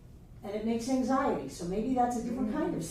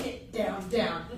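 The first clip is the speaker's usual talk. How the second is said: stern ego voice; 5 semitones lower than usual. Acted. Teacher voicing the ego silencing the inner self, low and stern. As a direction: push the little voice back down.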